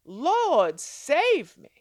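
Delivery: loud urgent plea; crying out. Shown as a demonstration. Preacher shows sisters how to cry out, loud plea. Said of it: "Cry out".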